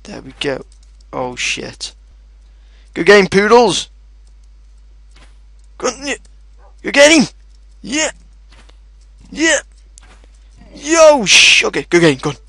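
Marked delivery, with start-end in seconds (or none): 2.9-3.9 shouted high cheer to the dogs; big triumphant yell; excited cheer. Real, not acted. Gamer cheering his pet dogs, loud and high. 5.8-12.4 high, loud whoops; excited cheering. Real, not acted. Gamer celebrating the dogs' win with high whoops. Cheering after winning fight.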